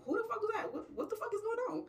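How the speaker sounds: high-pitched, baffled demand; incredulous. Acted. Friends hearing a stranger on the phone, incredulous. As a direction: demand answers, voice way up.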